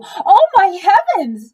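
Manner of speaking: high, loud and slow shocked cry; alarmed cry. Acted. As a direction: cry out like a frantic mother. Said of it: "she cried".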